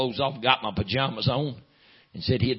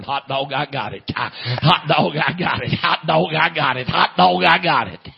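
imitated hollering; 6 dB louder, slower than usual. New convert running the aisles, imitated joyful hollering. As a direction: start contained and build with each repeat.

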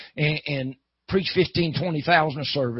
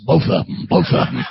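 louder acted reply from an excited church lady; loud punchline in her voice; lady's retold reply; 7 dB louder than usual. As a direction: blurt it out as the woman in the story. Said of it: "She said".

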